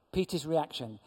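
ordinary delivery

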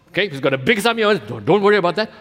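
ordinary talk, nothing marked

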